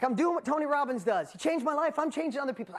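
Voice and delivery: high, loud and eager; brimming with mission; on fire. Retold or imitated. Young Dean proud to be like Tony Robbins, excited. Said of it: "I'm like".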